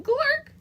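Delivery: very high, loud swallowing "Glork"; Glork gulp. Acted. Grandma swallowing the fly with a loud "Glork". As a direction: gulp it down with a squawk. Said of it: "Grandma said".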